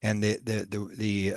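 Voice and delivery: normal talking voice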